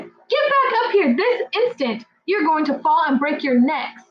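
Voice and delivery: high, urgent scolding; mother bird scolding her chicks. Acted. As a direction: order the chicks back in alarm.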